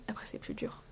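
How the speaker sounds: soft unvoiced whisper; whispered aside. Real, not acted. Fan whispers that the later films get harder, whispered. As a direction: drop into a whisper, regretful.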